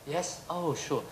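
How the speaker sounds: a soft, offhand "yes, oh, sure"; polite murmur. Retold or imitated. Lecturer mimicking a European humouring visitors, soft murmur. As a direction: murmur it like a bored host.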